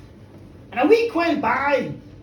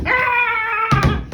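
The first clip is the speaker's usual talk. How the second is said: long, loud high horse screech; angry horse scream; 7 semitones higher, 6 dB louder than usual. Acted. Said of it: "trying to kick other folk".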